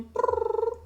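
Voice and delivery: rolled high purring noise; cat purr imitation. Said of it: "j'ai entendu prrrr".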